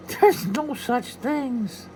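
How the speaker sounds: high, very slow leprechaun; a stubborn little leprechaun. Acted. Leprechaun Patrick.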